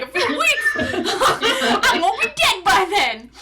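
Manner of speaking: high, laughing, slow disbelief. Retold or imitated. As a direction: laugh through the outrage. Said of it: "I was like".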